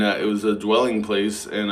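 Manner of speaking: usual speaking voice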